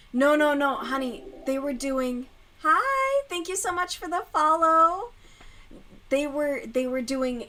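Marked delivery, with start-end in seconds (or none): 2.6-5.1 high, loud bright greeting; chirpy thanks. Real, not acted. Thanking a new follower mid-rant, high and cheerful. Reading a stream comment aloud.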